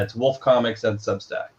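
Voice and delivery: ordinary delivery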